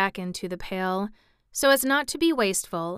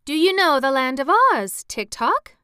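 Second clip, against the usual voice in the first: eager, higher and louder question; an eager girl's question; 7 semitones higher, 9 dB louder than usual. Acted. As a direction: ask hopefully and brightly. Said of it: "asked in an eager tone".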